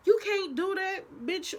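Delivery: high, loud scolding nurse. Retold or imitated. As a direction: pitch it up as the nurse. Nurse voiced in retold story.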